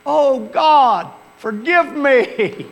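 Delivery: high, loud wailing plea; mock child's desperate wail to God; loud cry. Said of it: "Just loud as he could".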